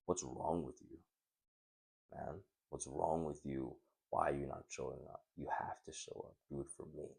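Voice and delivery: soft, deliberate plea, falling almost to a whisper; hushed self-confrontation. Pretend. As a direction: confront yourself under your breath, pleading and firm. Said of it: "getting really angry and aggressive with yourself".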